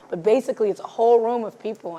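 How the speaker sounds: ordinary delivery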